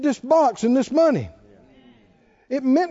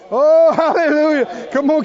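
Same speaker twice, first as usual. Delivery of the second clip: exultant hallelujah; 3 semitones higher, 6 dB louder than usual. Real, not acted. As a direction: let the praise ring out. Preacher exults.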